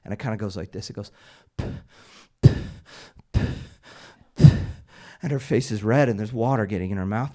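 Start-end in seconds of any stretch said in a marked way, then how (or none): 1.6-4.6 short puffs forced through the lips; mimicked puffing of a struggling swimmer; labored spitting breaths. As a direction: imitate someone puffing water away, spaced out. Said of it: "doing this kind of breathing".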